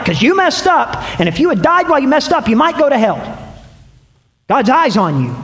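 hammed-up altar-call threat; fear-driven preacher. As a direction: imitate an old scare-them sermon, pressing and insistent. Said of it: "I used to preach those verses".

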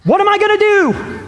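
put-on high, frightened little boy's cry; high-pitched scared child asking for help; panicked boy. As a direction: panic like a kid caught out. Scared boy after killing duck.

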